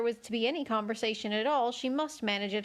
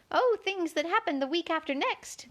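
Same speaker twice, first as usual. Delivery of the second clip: careless tone; 7 semitones higher than usual. A narrator's reading. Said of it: "in a careless tone".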